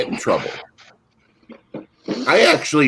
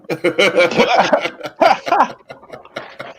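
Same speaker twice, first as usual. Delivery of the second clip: laughing; 9 semitones higher than usual. Real, not acted. Laughing after the cheer.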